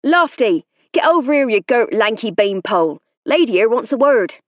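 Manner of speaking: loud, high shout across the pitch; yell. A narrator's reading. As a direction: bellow at a teammate from afar. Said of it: "he yelled".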